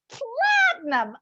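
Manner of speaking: platinum drawn out high and scornful. Shown as a demonstration. Telling how the studio bleached her hair, a scornful platinum.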